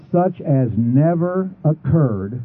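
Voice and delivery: Such as never occurred, very slow; grave slow scripture line; slow solemn reading. Shown as a demonstration. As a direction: draw it out with weight. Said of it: "Now watch".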